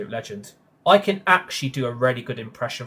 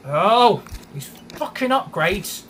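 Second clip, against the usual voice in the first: angry outburst; 8 semitones higher, 6 dB louder, slower than usual. Real, not acted. Raging at game upgrades.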